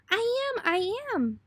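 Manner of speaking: high-pitched urgent "I am, I am"; eager unheard shout. Acted. Trapped son answering his mother, high and urgent. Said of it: "Sylvester wanted to shout".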